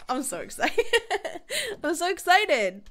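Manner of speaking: excited words broken by real laughter; giddy laughing. Real, not acted. Player thrilled at finishing the bundles, laughing giddily. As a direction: laugh through it, bursting with excitement. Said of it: "I'm laughing like a little weenie".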